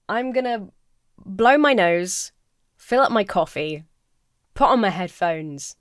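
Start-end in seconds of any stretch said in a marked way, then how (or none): none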